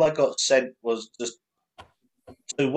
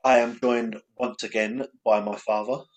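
ordinary talk

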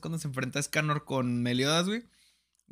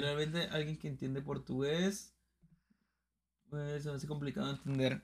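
mumbled mock reading; 11 dB quieter than usual. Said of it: "¿Qué dice?".

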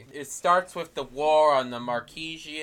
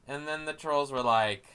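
ordinary talk

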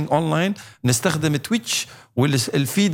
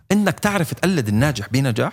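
ordinary delivery

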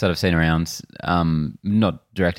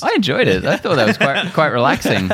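laughing; 8 semitones higher, 4 dB louder than usual. Real, not acted. Teasing about an awkward pause.

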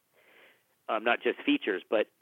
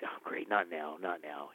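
inner grumble; 6 semitones lower, 10 dB quieter than usual. Retold or imitated. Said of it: "And I'm like".

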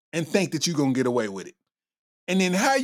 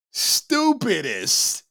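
drawn-out stupidest; 4 semitones higher, much slower than usual. Real, not acted. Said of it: "that got to be the stupidest".

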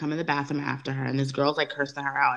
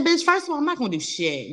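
much higher, sharp confrontational snap; mock clapback, hammed up and higher; New York attitude; 11 semitones higher than usual. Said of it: "the New York coming out".

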